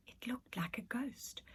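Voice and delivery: slow breathy whisper; spooky whisper. A narrator's reading. Narrator reading the spooky moment in a slow whisper. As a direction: hush it like a ghost story.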